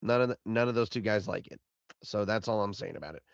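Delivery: ordinary talk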